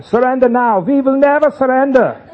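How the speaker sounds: high, very loud mock-Prussian megaphone bellow; bad Prussian accent. Enemy demanding surrender of parents in trenches, bad Prussian accent. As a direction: holler it in a hammed-up, terrible Prussian accent. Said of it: "is that a Prussian accent?".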